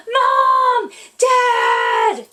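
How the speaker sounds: high, loud shouts called to parents; child bellowing for Mom and Dad from bed; shouting loud. Acted. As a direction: yell from your room to wake them. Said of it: "shout really loud from your room".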